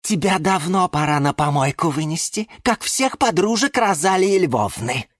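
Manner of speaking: high, spiteful jab; high-pitched venomous scorn; malicious hiss. A narrator's reading. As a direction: spit out a vicious insult. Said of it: "злобно шипела орхидея Галатея".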